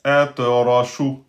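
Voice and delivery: Atrashu read slowly, syllable by syllable; teacher modelling a word slowly; slow model reading. Shown as a demonstration. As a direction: spell out Atrashu slowly for the class. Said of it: "Et on a Atrashu".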